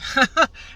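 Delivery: short high burst of laughter; quick loud laugh. Real, not acted. Laughing at dead car.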